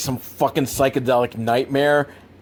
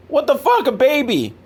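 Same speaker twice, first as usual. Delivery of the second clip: mock shock; 12 semitones higher, 3 dB louder than usual. Imagined driver reacting to baby.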